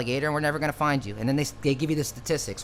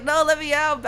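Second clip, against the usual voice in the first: begging; 13 semitones higher, 5 dB louder than usual. Retold or imitated. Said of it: "I'm begging".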